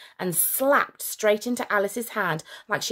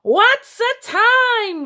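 high, loud, slow monster roar; beast roar; 9 dB louder, much slower than usual. A narrator's reading. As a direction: roar in exasperation. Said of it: "roared the World Stopper General".

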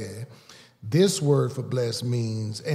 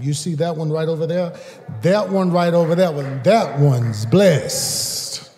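higher and louder, pointing with pride; proud exclamation; 6 semitones higher, 9 dB louder than usual. Acted. Preacher voicing God boasting to the devil about a believer. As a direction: call it out like showing off a prize.

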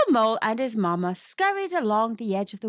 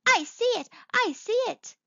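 high-pitched, eager little cry; excited little mole; 12 semitones higher than usual. A narrator's reading. Little mole answers mamma, crying out high and eager. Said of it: "cried Little Mole".

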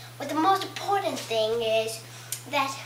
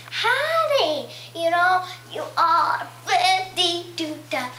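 mock Southern twang, half sung; hammy Alabama drawl, singsong; Southern accent; 3 dB louder, slower than usual. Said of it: "Alabama and Tennessee".

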